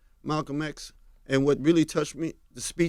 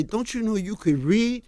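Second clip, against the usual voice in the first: slow, moved father's voice, a touch higher; understated tearful father; father near tears; 5 semitones higher than usual. Retold or imitated. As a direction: retell his father's words gently, slowly, as if tearing up. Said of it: "tears is welling up in his eyes".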